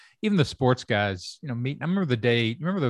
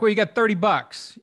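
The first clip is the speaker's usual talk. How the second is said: high, louder sarcastic quip; mock budget shrug; 10 semitones higher, 4 dB louder than usual. Mocking the show's cheap budget with a high quip. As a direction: toss off the budget line, pitched up.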